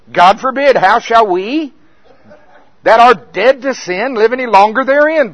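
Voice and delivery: loud, high preaching of the verse; forceful scripture quote. Retold or imitated. Preacher quoting Paul's rebuke to himself, loud and high. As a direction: proclaim it like Paul scolding.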